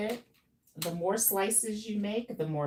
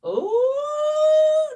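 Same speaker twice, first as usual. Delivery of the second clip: much higher, louder delighted "Ooh"; excited ooh; 13 dB louder than usual. Real, not acted. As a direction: squeal over the photo. Sees client's photo.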